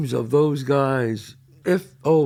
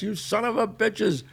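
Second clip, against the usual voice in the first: cursing; 8 semitones higher, 3 dB quieter than usual. Retold or imitated. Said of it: "he would curse us".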